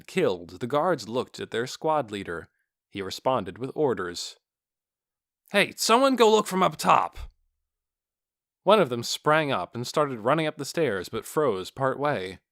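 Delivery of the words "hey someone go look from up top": barked order. A narrator's reading. Squad leader gives orders.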